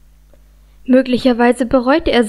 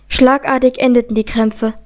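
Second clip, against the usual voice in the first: ordinary delivery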